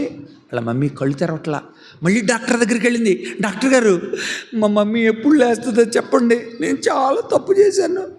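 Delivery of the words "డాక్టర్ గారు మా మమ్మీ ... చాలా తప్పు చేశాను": put-on high, anxious child voice; pleading child. Reena pleading with the doctor, tearful. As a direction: beg the doctor for news.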